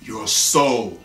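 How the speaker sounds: low, slow and drawn out on soul; ominous low demand after a long pause; sinister soul collector. Retold or imitated. Collector naming his price to the girl, sinister and low.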